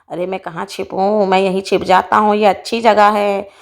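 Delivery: low creature voice plotting to hide; sneaky snake. Acted. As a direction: speak low, looking for a place to hide. Snake speaks as it hides.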